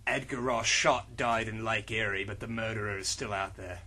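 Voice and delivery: low, soft gravel of a tough hero; low, quiet tough-guy voice; gruff hero voice. Acted. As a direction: read it like a brooding action hero. Reads character lines in low voice.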